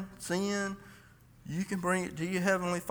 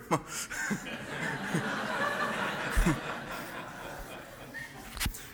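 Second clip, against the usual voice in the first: soft laugh; 3 semitones higher, 5 dB quieter than usual. Real, not acted. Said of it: "Now, I had just heard him".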